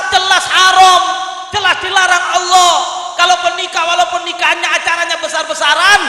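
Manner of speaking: loud, high sermon delivery; full-force preaching. Real, not acted. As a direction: preach it hard to a crowd.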